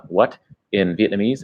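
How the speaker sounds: ordinary talk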